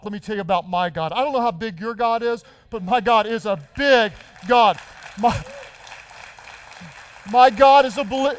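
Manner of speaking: loud, rising sermon build; full-force preaching. Acted. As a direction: proclaim my God with rising fire.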